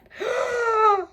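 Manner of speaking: long high-pitched gasp; sharp, thrilled intake of breath; delighted gasp. Real, not acted. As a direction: gasp at a lovely surprise. Gasps on seeing the fabric.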